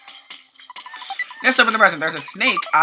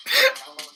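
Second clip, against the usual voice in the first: high laugh; 13 semitones higher than usual. Real, not acted. Laughing at own joke.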